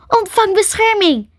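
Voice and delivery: high-pitched commanding spell cast; calling out. A narrator's reading. Ellen casting shield on poisoned Crystal, calling out. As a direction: call the spell out forcefully. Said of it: "riep Ellen".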